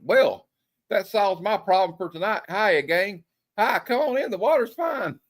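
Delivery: high, chipper mock greeting; cheery comic character. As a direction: call out gaily to the gang, pitch climbing. Acted comic-panel greeting read aloud.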